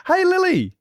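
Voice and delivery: high, loud call. Real, not acted. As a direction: call her name across the room. Calling out to Lily.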